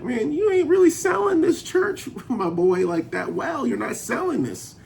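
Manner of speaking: high, laughing disbelief at a weak sales pitch; mock disbelief. Narrator teasing the pastor about his weak church pitch, high laughing disbelief. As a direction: tease him, voice jumping up high in amused disbelief.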